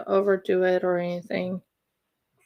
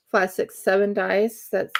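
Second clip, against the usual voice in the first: ordinary talk, nothing marked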